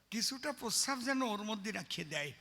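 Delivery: ordinary talk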